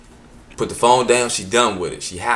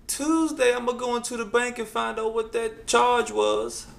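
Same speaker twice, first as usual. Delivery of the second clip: high stubborn mom; 12 semitones higher than usual. Retold or imitated. Mother's stubborn plan.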